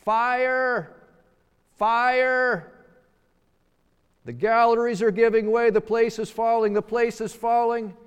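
loud, high alarm cry; panicked yell. Acted. Someone yells mid-service to a packed hall, alarmed shouting. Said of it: "somebody yells".

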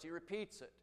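ordinary talk

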